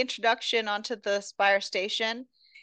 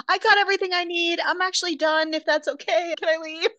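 eager to leave; 8 semitones higher than usual. Retold or imitated. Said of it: "And she's like".

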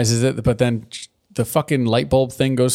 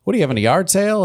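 quick, high chirpy Pinkie Pie voice; mock Pinkie Pie; 7 semitones higher, faster than usual. Pinkie Pie pestering Cranky about his unpacking, chirpy. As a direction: bounce in fast and nosy. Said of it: "Pinkie Pie rolls up".

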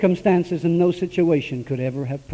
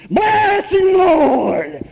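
high loud hammed-up blessing; mock shouting churchgoer; 10 semitones higher, 6 dB louder, much slower than usual. Preacher mimicking a ruffian blessing him at the altar, loud. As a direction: bellow it like an overzealous brother. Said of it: "some ruffian comes up".

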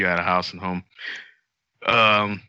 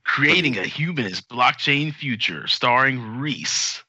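commercial voice; 3 semitones higher, slower than usual. Pretend. Host re-announcing Reese's podcast title, mock commercial voice. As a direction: announce it like a show trailer. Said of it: "Here's my commercial voice".

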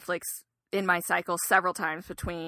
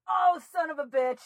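frustrated curse; 7 semitones higher, 3 dB louder than usual. Real, not acted. Spider escapes.

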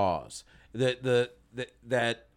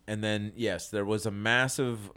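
normal talking voice

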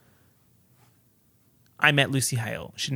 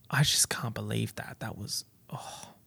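real hushed whisper, overwhelmed; whispered disbelief; 3 semitones lower, 9 dB quieter than usual. Real, not acted. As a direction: let the voice vanish into a whisper. Overwhelmed recalling the meeting.

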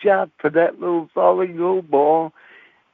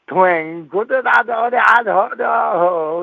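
higher, drawn-out twang and da-da plucks; banjo twang; 5 semitones higher, slower than usual. Retold or imitated. Boy kneeling and strumming his proposal, mock banjo. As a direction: pluck it out slowly with your voice.

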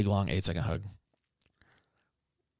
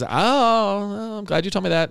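high, drawn-out "oh" reaction; put-on glad-I-found-out voice; mock relief; 8 semitones higher, slower than usual. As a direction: act it out, starting high. Voices child's reaction to being shouted at.

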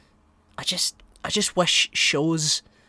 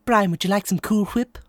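raised pitch, sketch-character voice; cartoon character bit; 7 semitones higher than usual. Closing the podcast with a Family Guy bit, cartoon character voice. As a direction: play the cartoon character offering dessert.

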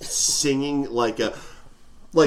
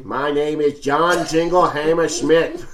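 lower-pitched mock Boston accent; 4 semitones lower than usual. Host tries the song line, mock Boston accent. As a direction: put on a hammed Boston accent. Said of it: "How about Boston?".

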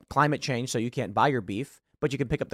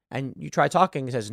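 usual speaking voice

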